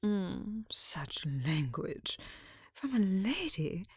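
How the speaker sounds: slow, scornful whisper; mocking whisper. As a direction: savour the mockery, drawn out. Rival mocks in whisper.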